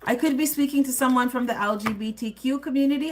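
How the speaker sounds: usual speaking voice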